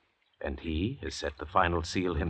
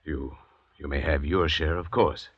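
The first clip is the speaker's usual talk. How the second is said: normal talking voice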